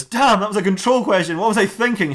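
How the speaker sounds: high comic exasperation; frustrated self-reproach. Acted. Detective realising he wasted his question, frustrated. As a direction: kick yourself in a hammed-up high voice.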